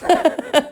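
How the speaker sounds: high giggling laugh; burst of laughter. A narrator's reading. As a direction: burst out laughing as the amused bear. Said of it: "The bear burst out laughing".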